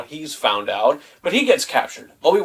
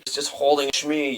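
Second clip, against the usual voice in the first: ordinary talk, nothing marked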